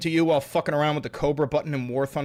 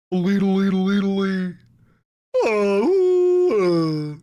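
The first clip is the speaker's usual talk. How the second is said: babbled trill, then a long swooping hoot; playful warbling and hooting; silly vocal noises; 2 semitones higher, 5 dB louder, slower than usual. Pretend. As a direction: babble quickly, then wail a sustained "oh".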